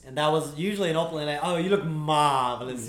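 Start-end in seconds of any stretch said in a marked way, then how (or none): 1.4-2.9 smooth schmoozy compliment; Billy Crystal impression. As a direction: lay on the charm like a sleazy flirt. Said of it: "that's how he would do it".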